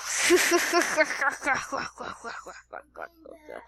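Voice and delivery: long, stuttering cackle that fades; hammed-up wicked laughter; evil laugh. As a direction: cackle on and on like a villain. Villain laughs after boast.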